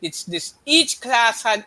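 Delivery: usual speaking voice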